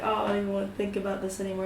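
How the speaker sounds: fast, quiet rushed whine; fast, quiet rushed mumble. Retold or imitated. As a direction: blurt it away quickly. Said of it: "Kane's like".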